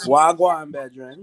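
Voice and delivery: put-on Jamaican accent. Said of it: "the first thing they always say".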